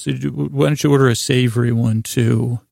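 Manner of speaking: ordinary delivery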